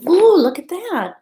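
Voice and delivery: high, bright-eyed marvel at the sprout; delighted ooh. Acted. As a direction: sell the wonder to the kids. Teacher showing sprouting bean.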